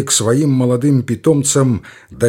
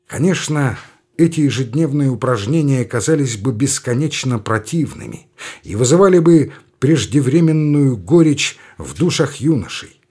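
ordinary delivery

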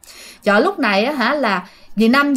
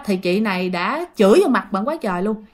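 ordinary talk, nothing marked

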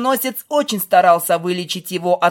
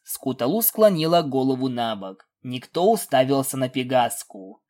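usual speaking voice

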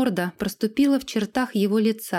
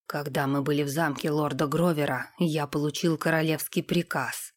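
low, softer, even voice; composed retainer Sam; 5 semitones lower, 4 dB quieter than usual. A narrator's reading. Sam confesses a royal order to the lord, composed. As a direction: report it quietly and impassively.

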